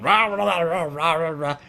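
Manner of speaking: loud high raging yells, dropping off; mock rage yelling. Yelling at the camera in mock rage. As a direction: roar like a raging reviewer. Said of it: "I can just be up here going".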